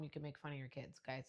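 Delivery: ordinary talk, nothing marked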